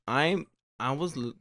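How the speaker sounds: normal talking voice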